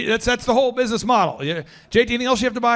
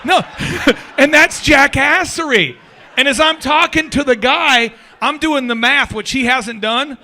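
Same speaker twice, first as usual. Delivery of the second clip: loud amused rant; 6 semitones higher, 6 dB louder than usual. Real, not acted. Ranting about the delivery guy.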